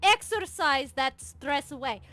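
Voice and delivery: loud, high commanding pep talk; loud bossy coach. Acted. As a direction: bark orders like a coach. Coach-like sister orders.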